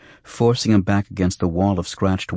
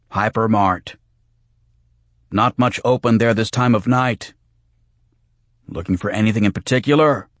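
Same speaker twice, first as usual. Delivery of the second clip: raised, louder cabbie voice; higher, chatty cab driver; cabbie over intercom; 4 semitones higher, 5 dB louder than usual. Acted. Cabbie over speaker grid.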